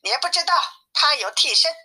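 high-pitched, hammed-up storybook whine of protest; clamouring protest. A narrator's reading. Bajie complains to his master about Wukong, high clamour. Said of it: "八戒跳着嚷着说".

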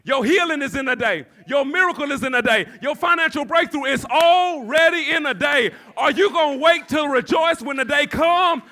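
rhythmic, building pulpit shout; full-force preaching. Real, not acted. Preacher urging congregation to receive the blessing now, full-force preaching. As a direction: hammer each promise, rising to a shouted challenge.

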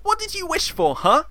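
high-pitched nagging stage mother; aggrieved mum, hammed up; scolding pantomime dame. Plays scolding Mother Jamalio.

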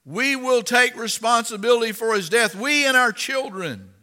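high, loud crowd chant; people yelling back. Acted. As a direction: yell it back as one defiant crowd. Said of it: "all the people yelled back".

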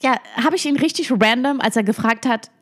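ordinary talk

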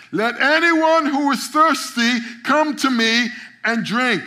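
high, loud, slow proclamation. Acted. Jesus crying out at the festival, loud and slow. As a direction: proclaim it to the whole festival crowd. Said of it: "he said in a loud voice".

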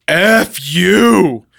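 drawn-out mock-angry retort; acted defiant outburst; mad retort. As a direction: stretch both words in retold anger. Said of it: "Kyle is already too mad".

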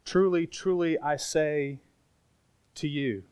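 soft, spaced-out, intimate quote; personal. Retold or imitated. Preacher quoting Jesus as addressing each listener, softly and personally. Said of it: "he says personally".

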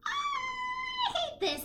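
very high, slow, stretched-out whine; mock whiny wail. Mocking the movie heroine's complaint, high stretched whine. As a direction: wail it like a spoiled, overwrought heroine.

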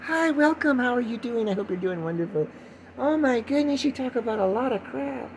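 high-pitched welcoming character voice; hammed-up high host voice; put-on chirpy greeting. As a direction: chirp in exaggerated falsetto politeness. Mock greeting and first-time listener reply.

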